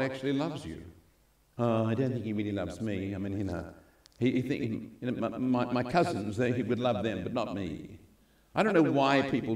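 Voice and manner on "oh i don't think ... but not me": softer, mumbling insecure believer; doubtful, self-pitying voice. Retold or imitated. Preacher mimicking someone who feels unloved, softly. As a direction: sound unsure you are loved.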